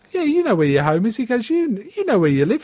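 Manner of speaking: high, helpful coaxing voice; sing-song helpful directions; wife coaxing. Retold or imitated. Bill's wife giving Dougie directions, high and coaxing. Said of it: "his missus is going".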